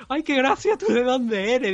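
repeating the teacher's condescending line as a high, hammed-up parody; mocking the teacher again. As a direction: ham up her patronising tone, way up high. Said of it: "qué es eso de decirle a un alumno".

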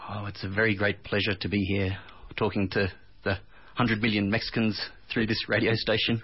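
natural, flattened Australian English; Australian accent. Shown as a demonstration. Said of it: "Now say it in Austra-, uh, Australian".

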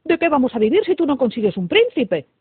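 loud parental outcry; indignant exclamation. A narrator's reading. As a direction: demand an answer, alarmed and put out. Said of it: "exclamaron el rey".

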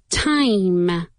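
"Time" with a long, firm final m; strong M. Shown as a demonstration. Teacher showing the ending, "Time" with a strong m. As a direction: close hard on the m of "Time". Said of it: "Con una M fuerte".

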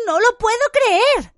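very high, loud, drawn-out cry; slow, high-pitched shout of disbelief; shrieked disbelief. A narrator's reading. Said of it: "gritó Hermione".

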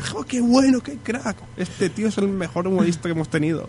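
high, gushing praise; thrilled fan. Retold or imitated. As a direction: rave like a delighted fan. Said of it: "y dice".

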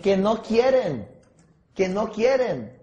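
QUIEREN drawn out, slow and loud; emphatic repetition. Real, not acted. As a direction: hammer home each slow "no quieren". Trainer hammers home the answer. (recalcando despacio y con fuerza «no quieren»)

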